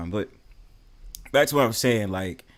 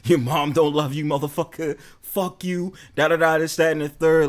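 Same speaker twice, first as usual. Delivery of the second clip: higher and louder mock trash talk; hammed-up roast; 5 semitones higher, 4 dB louder than usual. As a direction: roast them loudly, just playing. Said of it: "I'll roast people".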